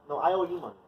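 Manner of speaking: usual speaking voice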